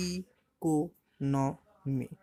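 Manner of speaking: "Economy" drawn out in four steady beats; split into syllables. Shown as a demonstration. Teacher showing it takes four beats, "economy" split up. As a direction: say it very slowly as ই-কো-ন-মি. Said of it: "তো এটা কবার হচ্ছে? চারবার".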